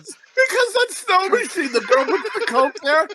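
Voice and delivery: high-pitched, giggling through every word; can barely get the story out for laughing; laughing while telling. Real, not acted. Co-host retelling the snow machine scene, laughing throughout.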